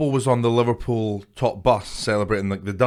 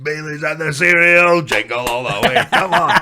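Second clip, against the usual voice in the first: mock rowdy Christmas cheer; 4 semitones higher, 4 dB louder than usual. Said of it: "You're like".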